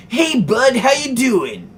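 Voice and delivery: high, bright-eyed over-eager hello; actor-enthusiastic greeting. Retold or imitated. Guy in the maga shirt greets the vlogger, over-enthusiastic. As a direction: greet him like a hammy stage actor. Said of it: "in the most actor enthusiastic way goes".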